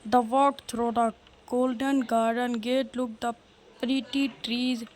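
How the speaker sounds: slow, drawn-out bedtime story read; hammed-up reading to a baby, very slow; mock storybook reading. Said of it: "अब मेरी सुनो". (धीमी, खिंची हुई कहानी पढ़ने की नकली आवाज़)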